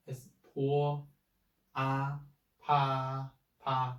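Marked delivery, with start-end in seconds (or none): 2.6-4.0 "pa" held level like a horizon; first tone. Shown as a demonstration. Teacher drills the syllable for learners, level first tone. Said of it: "the first tone".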